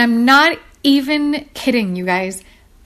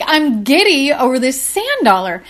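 ordinary delivery

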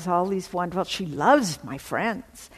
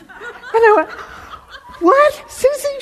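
much higher, loud, astonished question; stunned; 10 dB louder than usual. Retold or imitated. Answering friend's joyful call, loud stunned disbelief. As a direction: cry out in disbelief.

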